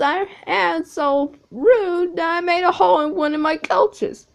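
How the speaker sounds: high, loud whining engine voice; a miserable little engine moaning in the shed; sad James. Acted. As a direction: James the engine wailing loudly about his shame.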